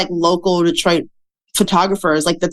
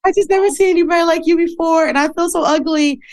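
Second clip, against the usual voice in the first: high, put-on voice of a tearful young fan; the speaker voicing the shy hijabi girl who cried to her, high and tearful; imitating a crying girl; 10 semitones higher than usual. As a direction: mimic the girl choking up, pitched well up. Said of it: "She's just like".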